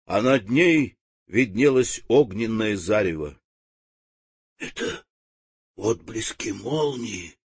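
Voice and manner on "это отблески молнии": тихий поражённый шёпот; прошептать в изумлении. Acted. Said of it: "шептали поражённые мараны". (испуганный шёпот)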